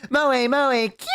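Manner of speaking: high, cute maid chant; cutesy maid. Acted. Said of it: "where a maid is giving me heart-shaped things".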